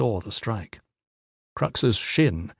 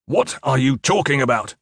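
mildly raised demand for answers; 3 semitones higher than usual. A narrator's reading. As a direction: demand an explanation from a trainee. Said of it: "Trainer Fisk demanded".

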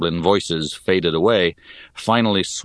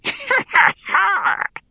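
high wheezing cackle; wheezing goblin laugh. Acted. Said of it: "He gave a high, wheezing laugh".

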